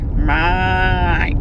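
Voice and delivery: lightly imitated broad Aussie "Mate", drawn out and falling; a hint of the Aussie man stretching "Mate". Imitating the Aussie guy at the gin tasting, drawn-out "Mate". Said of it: "that's how he would say it".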